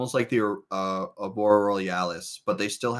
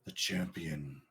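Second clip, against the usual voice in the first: low, hushed, mysterious narration; ominous whisper; 7 semitones lower, 19 dB quieter than usual. Acted. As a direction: whisper it like a prophecy from the void.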